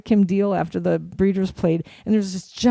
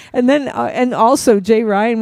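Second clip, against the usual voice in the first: normal talking voice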